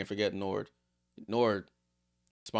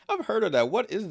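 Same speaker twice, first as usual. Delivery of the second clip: laughing; 10 semitones higher, 4 dB louder than usual. Real, not acted. Chat mentions Vegemite.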